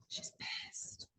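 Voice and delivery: very soft, confiding whisper; whispered aside. Real, not acted. Confiding to the room about the teen, whispered aside. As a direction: drop right down to a whisper.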